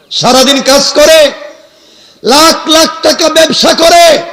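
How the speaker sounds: high, loud chant-like list, punched phrase by phrase; boastful preaching shout. Acted. Preacher acting a trader claiming everything, boastful shout. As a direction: proclaim each possession to the crowd.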